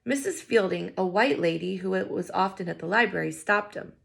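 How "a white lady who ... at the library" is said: plain reading voice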